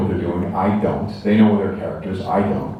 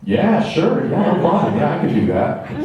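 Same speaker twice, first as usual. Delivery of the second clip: higher, overeager bluffing yes; faked confidence; 6 semitones higher than usual. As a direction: agree too readily, badly faking confidence. Faking confidence to get the job.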